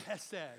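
Chesed with a harsh, spitting ch from the throat; throat-clearing ch. Shown as a demonstration. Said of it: "Clear your throat like you're gonna spit".

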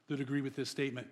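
usual speaking voice

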